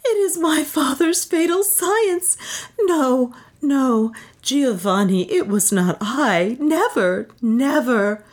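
high-pitched, distraught denial; anguished high cry; shrieked protest. A narrator's reading. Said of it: "shrieked Beatrice".

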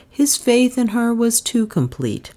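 usual speaking voice

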